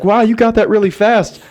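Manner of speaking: high, gushing mock of the girl; impressed girl. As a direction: play the girl as wowed.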